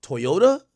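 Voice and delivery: Toyota as "Toy-ota", rising in question; American pronunciation. Shown as a demonstration. Checking how y'all say it, American "Toy-ota". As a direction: try Toyota with an "oy" in the first syllable. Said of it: "y'all say it?".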